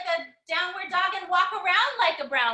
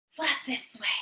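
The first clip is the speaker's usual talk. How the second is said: softly whispered cue; hushed whisper during yoga; 3 semitones lower, 8 dB quieter than usual. Real, not acted.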